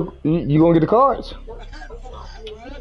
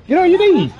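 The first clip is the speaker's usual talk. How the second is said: laughing taunt; 10 semitones higher, slower than usual. Real, not acted.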